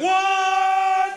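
high, loud shocked yell; startled shout. Retold or imitated. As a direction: shriek it like you've seen a ghost. Disciples freak out seeing Jesus.

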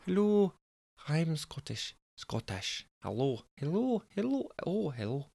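soft, high-pitched tryout of Scottish; Scottish accent warm-up. Shown as a demonstration. Narrator getting into the zone of a Scottish voice. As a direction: feel out a Scottish lilt, quietly. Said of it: "getting into my zone of a Scottish voice".

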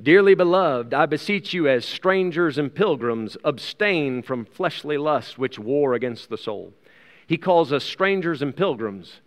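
normal talking voice

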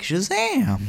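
high-pitched heroic cry; magic-word exclamation. Acted. Boy transforms into the man, exclaiming in a high voice. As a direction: call it out high, stretching the end.

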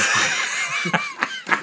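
very high giggle; squealing laugh. Real, not acted. Podcaster squeals at a friend's jab, laughing.

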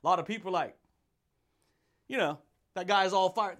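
ordinary talk, nothing marked

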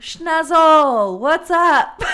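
high, loud, hammed-up hello; loud mock greeting. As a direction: shout a hammy hello.

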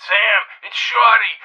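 ordinary delivery

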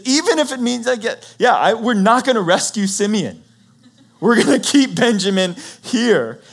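high, loud refusal in an altered voice; an old father digging his heels in; stubborn father. Retold or imitated. Jacob refuses his sons, high and stubborn.

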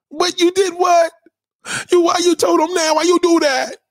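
high, loud disbelief; shocked friend. Acted. Girlfriend shocked she said I love you, high and loud. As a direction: scold her in shock.

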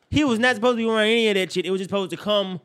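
normal talking voice

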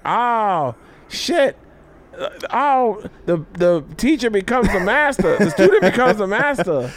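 high-pitched, delighted impression of a proud mentor; mock amazed reaction. Imagining a mentor's reaction to the tour bus, mock amazed.

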